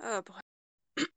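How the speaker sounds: usual speaking voice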